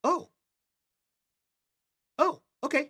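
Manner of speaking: put-on very high, small-girl voice; high-pitched hesitant okay; surprised child. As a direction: respond as a little girl caught off guard. Child reacts to being escorted.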